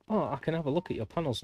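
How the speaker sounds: high, sing-song fake cheer; mock spammer. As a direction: parody an eager seller. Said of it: "And then they go".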